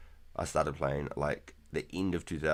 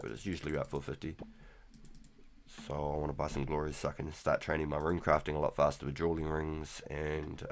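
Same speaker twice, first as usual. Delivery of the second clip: normal talking voice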